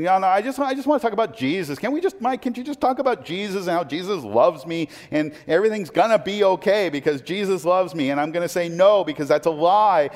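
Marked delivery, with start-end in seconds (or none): 0.0-8.1 higher, pleading mock objection; whiny churchgoer. Pastor mimicking a congregant who only wants comfort, mock whine. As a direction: whine like someone dodging hard teaching. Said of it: "and you're thinking".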